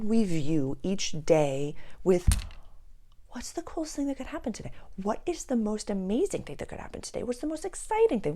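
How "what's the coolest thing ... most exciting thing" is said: high-pitched, eager wondering questions; childlike wonder. Acted. Showing viewers how to greet each day, high childlike wonder.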